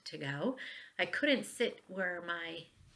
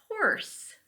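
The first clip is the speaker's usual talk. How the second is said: horse sung out much higher; cheerful answer; 13 semitones higher than usual. Shown as a demonstration. Teacher modelling the answer, much higher bright horse. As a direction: pop the answer up high. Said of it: "If I say Storse, not Storse".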